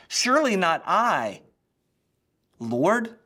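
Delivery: high, loud plea with Surely stressed; "Surely not I, Lord?" cried out high and loud; anxious disciples. Acted. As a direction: disciples pleading their innocence around the table. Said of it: "See the difference?".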